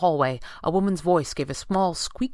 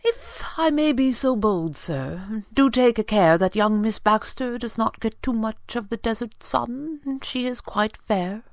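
higher-pitched, measured butler voice; deferential butler; 6 semitones higher, slower than usual. A narrator's reading. Butler cautiously advising his employer, deferential. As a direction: venture advice with careful politeness.

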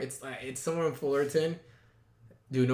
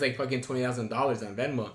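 ordinary talk

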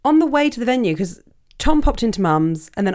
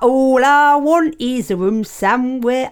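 slow sung line, hammed-up Mockney accent; 5 semitones higher, 4 dB louder, slower than usual. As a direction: belt it out in a bad Mockney accent. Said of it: "in a terrible Mockney accent".

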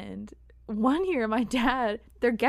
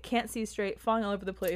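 ordinary delivery

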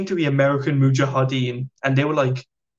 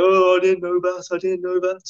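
nervous inmate; 5 semitones higher than usual. Retold or imitated. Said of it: "And he starts sweating".

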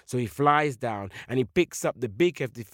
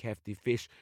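ordinary delivery, nothing marked

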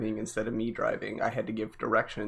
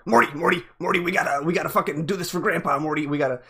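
raised, louder Rick voice, fast and slurred; Rick Sanchez impression; 5 semitones higher, 5 dB louder, faster than usual. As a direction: do a quick Rick Sanchez bit, pitched up. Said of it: "when somebody does a".

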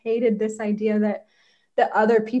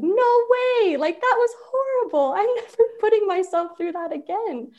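emphatic refusal; 9 semitones higher than usual. Retold or imitated. Wife refusing husband's idea of ski lessons, emphatic and high. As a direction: reject it outright, half-laughing. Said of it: "at first I was like".

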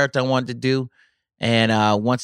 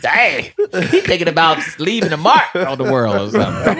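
laughing; 4 semitones higher, 4 dB louder than usual. Retold or imitated. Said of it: "I'm like".

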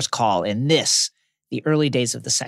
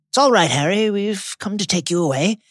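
Lupin voice; 4 semitones higher than usual. A narrator's reading.

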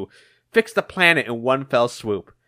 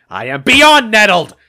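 loud, high-pitched exasperation; angry outburst; 7 semitones higher, 9 dB louder than usual. Acted. Eggman corrects Phage on how angry he is, outburst. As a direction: explode on BEYOND in outrage. Acted villain quarrel with Phage.

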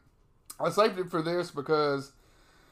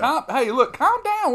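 pitched-up yelling calm down; high mock shout; 8 semitones higher, 7 dB louder, slower than usual. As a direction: squeal it out in mock alarm.

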